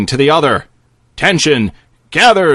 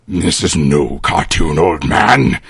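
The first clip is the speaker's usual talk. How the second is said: very low, dark necromancer voice; menacing villain; 13 semitones lower than usual. Acted. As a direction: sneer it from deep down. Necromancer answers the old man.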